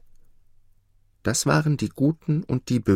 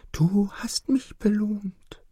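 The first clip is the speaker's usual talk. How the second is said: the nightingale's high hushed reply; nightingale whisper; 13 semitones higher, slower than usual. Acted. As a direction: whisper it tenderly as a small bird. The nightingale answers softly.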